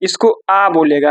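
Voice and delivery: आ said as a long open a, slowly; German letter name. Shown as a demonstration. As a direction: stretch out आ like the German A. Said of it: "लेकिन German में इसको ए नहीं".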